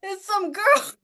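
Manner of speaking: high, loud, tearful laughter; laugh-crying while telling the story; laughing through it. Real, not acted.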